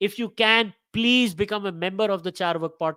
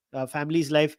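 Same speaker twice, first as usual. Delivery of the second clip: normal talking voice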